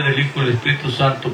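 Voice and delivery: ordinary delivery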